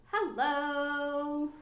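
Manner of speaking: sweet sung hello; nice hello. Shown as a demonstration. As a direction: sing a kind hello to the kids. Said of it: "let's say hello as nice as we can".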